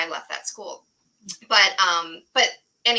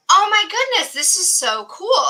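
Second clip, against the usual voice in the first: higher, louder gushing delight; excited teacher; 9 semitones higher, 7 dB louder than usual. Retold or imitated. Teacher sees the kids' art projects, excited gush.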